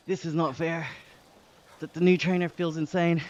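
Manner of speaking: breathless excitement on the climb; excited strain. Real, not acted. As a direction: push out the words while pedalling hard. Straining up a steep hill.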